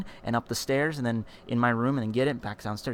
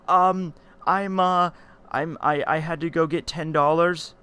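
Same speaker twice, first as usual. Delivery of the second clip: higher, stammering excuse; halting, raised kid voice; imitating nervous kid; 6 semitones higher, 5 dB louder, slower than usual. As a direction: stall like a kid caught sneaking. Caught kid stammers to dad.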